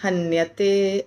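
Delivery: नायम हंति न हन्यते recited slowly; slow Sanskrit recitation; slow model recitation. Shown as a demonstration. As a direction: recite the line slowly and evenly. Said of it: "ऐसे".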